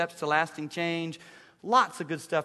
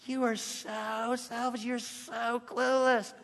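slow, higher, drawn-out scolding; mock scolding parent; 6 semitones higher, 5 dB quieter, slower than usual. Father mimics scolding his selfish teenager, slow and higher. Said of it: "I was guilty of saying".

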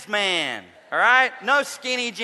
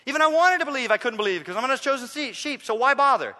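high, loud mock panic, words tumbling out fast; worked up, frothy; 8 semitones higher, 5 dB louder, faster than usual. Preacher mimicking a fretting churchgoer, worked-up and frothy. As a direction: imitate someone frantically fretting. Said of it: "get all worked up and frothy".

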